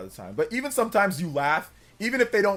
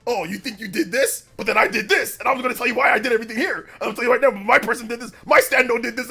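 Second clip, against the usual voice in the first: higher, louder, rapid mock boasting; gloating anime villain; 7 semitones higher, 8 dB louder, faster than usual. Streamer mocking a villain explaining his win, smug. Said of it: "when they start explaining why they're kicking your butt".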